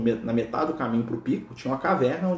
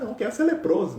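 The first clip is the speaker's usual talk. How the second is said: casual mock request, pitched up high; joking; 8 semitones higher than usual. Imagined believer asking Jesus for illness, joking. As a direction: toss it off as a playful joke. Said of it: "Tô brincando".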